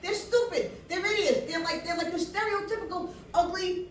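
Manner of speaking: high, excited inner rant; acted-out glee. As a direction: rush through it with high-pitched relish. Her excited inner reaction, acted out.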